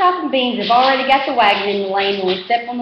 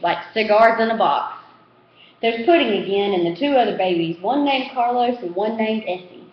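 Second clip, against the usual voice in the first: usual speaking voice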